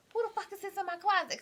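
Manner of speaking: a high mock yell of the furious father; angry dad. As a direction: imitate dad finding the boy in the closet. Dad voiced finding boy.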